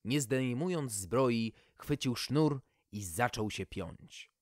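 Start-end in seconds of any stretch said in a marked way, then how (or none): none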